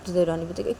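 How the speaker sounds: ordinary delivery, nothing marked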